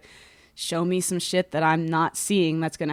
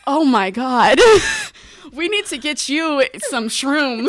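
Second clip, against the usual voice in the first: loud, high, laughing through a mock scolding; laughing mock pity; 7 semitones higher, 10 dB louder, slower than usual. Teasing her old self, laughing loudly through it. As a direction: cackle while pitying her younger self. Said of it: "I'd be like".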